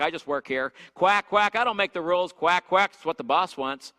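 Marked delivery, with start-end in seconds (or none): 0.9-1.6 a louder spoken quack, quack; mock duck quack. Preacher mocking yes-men as ducks, loud quack. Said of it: "talk about it as a duck pond". 2.3-2.9 a louder spoken quack, quack; mock duck quack. Preacher mocking yes-men as ducks, loud quack. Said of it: "talk about it as a duck pond".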